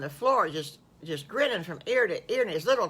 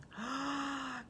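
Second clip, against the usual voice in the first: delighted gasp; 8 semitones higher, 9 dB quieter than usual. Acted. Wolf gasps in delight.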